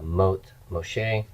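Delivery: teacher slowly modelling mot Moshe; slow careful demo. Shown as a demonstration. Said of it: "the death of Moses".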